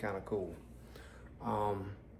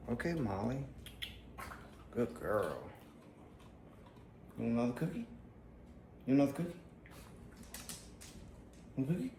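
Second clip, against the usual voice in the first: talking to a dog; 3 semitones higher, 4 dB quieter, faster than usual. Real, not acted. Offering the dog cookies.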